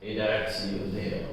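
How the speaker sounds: very soft, low and gentle; quiet old man. Shown as a demonstration. Old bootmaker pressing the sore spot, quietly. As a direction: murmur it knowingly. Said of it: "this accent".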